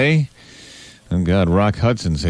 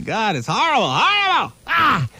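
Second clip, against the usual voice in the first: mock horror; 12 semitones higher than usual. Mock horror, high pitch.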